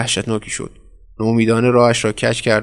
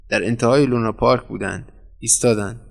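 usual speaking voice

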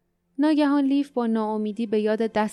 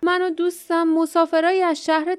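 raised, higher voice of protest; shouted protest; 6 semitones higher, 4 dB louder than usual. A narrator's reading. Lif denies being a Grey Guard, shouted protest. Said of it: "لیف فریاد زد".

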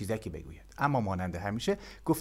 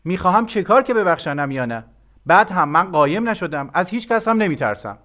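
loud, high-pitched defiant retort; indignant boy denying any fear; flushed, indignant; 8 semitones higher, 11 dB louder than usual. A narrator's reading. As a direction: protest hotly, raising pitch and volume. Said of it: "با چهرهٔ برافروخته گفت".

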